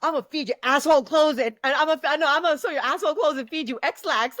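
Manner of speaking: trash talk. Retold or imitated. Spitting cousins' trash talk.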